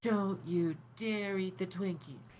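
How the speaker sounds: cute echo of the warning; mimicking back. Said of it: "She mimics back at you".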